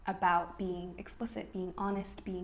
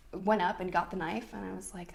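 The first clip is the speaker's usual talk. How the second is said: ordinary delivery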